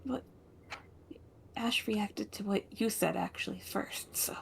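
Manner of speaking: soft unvoiced whisper. Real, not acted. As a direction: lean in and whisper it. Side remark to the player.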